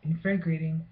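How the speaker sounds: proud announcement. Shown as a demonstration. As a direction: declare Infragrating like a new word. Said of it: "I made a new word".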